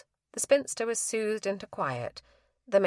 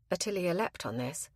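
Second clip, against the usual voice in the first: plain reading voice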